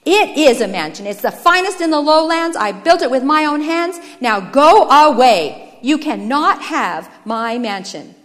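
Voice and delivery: loud, insistent, slowing into heavy words; forceful, indignant outburst; angry defiance. Acted. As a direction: defend what is yours, then order him off. Brother defends his hut angrily.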